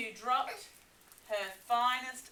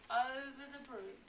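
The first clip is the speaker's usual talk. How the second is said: usual speaking voice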